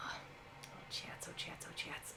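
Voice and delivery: very quiet, voiceless whisper. Real, not acted. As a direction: whisper it under your breath. Muttering to chat under breath.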